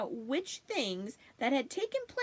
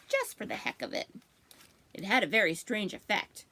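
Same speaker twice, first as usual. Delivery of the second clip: usual speaking voice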